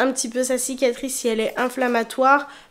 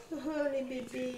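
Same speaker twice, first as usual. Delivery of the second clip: soft cooing; 18 dB quieter than usual. Real, not acted. Cooing at her cats.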